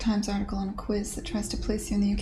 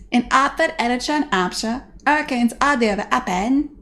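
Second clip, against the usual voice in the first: "Hertford" as 'ertford, higher and louder, h's dropped; hammed-up Cockney, pushed out louder; louder Cockney repeat; 4 semitones higher, 5 dB louder than usual. Streamer repeating Eliza's line louder in Cockney. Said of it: "quote Eliza Doolittle".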